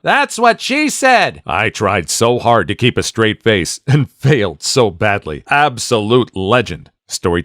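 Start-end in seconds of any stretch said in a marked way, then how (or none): 0.0-1.4 high, slow mock yell of a kid. Student yelling a joke at class, mock yell. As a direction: call it out across the class, playfully. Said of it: "another student yelled out".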